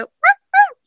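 very high, loud mewing "Ah, ah"; high cat cry mirrored. Retold or imitated. Copying her cat's high call back at him, very high mew. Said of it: "I mirror him sometimes".

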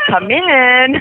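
high little parrot voice; imitating the parrot. Owner shows what King O says at his box door, light parrot voice. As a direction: mimic the bird answering a knock. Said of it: "He'll say".

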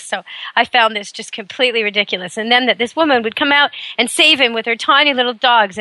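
ordinary delivery

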